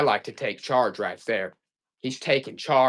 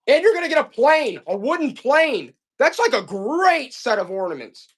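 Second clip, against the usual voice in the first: excited reveal; 9 semitones higher, 4 dB louder than usual. Real, not acted. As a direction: hype up the find with soaring pitch. Seller thrilled by wooden plane find.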